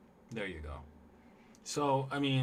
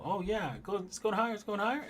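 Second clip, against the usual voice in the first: high, bright cheering of a buyer; mock gleeful trader; 11 semitones higher, 3 dB louder than usual. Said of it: "You're gonna go".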